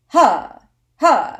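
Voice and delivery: short loud, high breath pushes from the gut; two forceful diaphragm huffs. Shown as a demonstration. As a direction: puff air out sharply from the diaphragm. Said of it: "Your diaphragm is actually right here".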